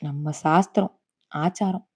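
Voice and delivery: low, soft, bitterly ironic; bitter scorn. A narrator's reading. Grandmother throwing tradition back at her son, quiet bitter scorn. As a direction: drop the voice and weigh each word with scorn. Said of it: "ஒரு கரித்த சிரிப்புடன் கூறினாள்".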